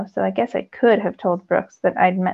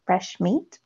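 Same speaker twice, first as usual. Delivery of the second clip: mouthed; 5 dB quieter than usual. A narrator's reading. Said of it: "mouthed slowly".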